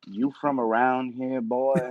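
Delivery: low Southern drawl; Southern local. Retold or imitated. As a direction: size up the outsider in a low Southern voice. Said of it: "whenever I've gone down south".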